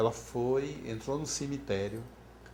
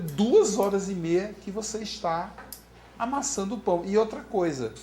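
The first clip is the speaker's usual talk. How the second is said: louder, higher retort, stressing each point; exasperated husband; 5 semitones higher, 3 dB louder than usual. Retold or imitated. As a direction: imitate a husband insisting in reproach. Marido discute com a mulher. (marido exasperado, imitado)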